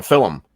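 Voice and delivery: "film" said as "fillum"; two-syllable FILM. Retold or imitated. Quoting the coach's line from the movie, "film" pronounced "fillum". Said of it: "he pronounces it film".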